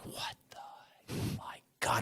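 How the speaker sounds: whispering in disbelief; stunned whisper. Retold or imitated. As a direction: breathe it out in shock. Agent stunned by the fake bank.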